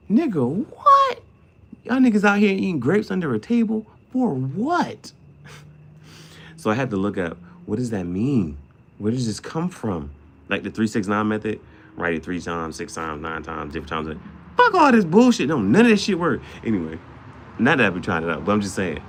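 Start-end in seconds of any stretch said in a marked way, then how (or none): 0.1-5.1 high, loud incredulous yell; incredulous outburst. Real, not acted. Ranting at viewers about eating grapes under tables. 14.6-16.4 high, loud scoffing rant; exasperated rant. Real, not acted. Dismissing manifestation tricks to viewers with a loud scoff.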